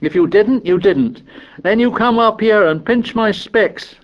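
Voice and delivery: indignant complaint. A narrator's reading. Piggy complains.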